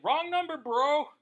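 hammed-up wrong number bro; incredulous protest. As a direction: play the reluctant recruit, wide-eyed. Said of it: "you're like".

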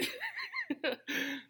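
soft, high-pitched laugh; high giggle. Real, not acted. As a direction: giggle at your own joke. Laughs after his own joke.

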